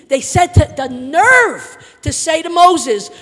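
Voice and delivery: loud, indignant sermon delivery; full-force preaching. Real, not acted. Preacher recounting Israel's complaints to congregation, full force. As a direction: preach it with force, stretching the key words.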